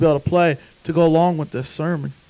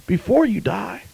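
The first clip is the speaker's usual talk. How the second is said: normal talking voice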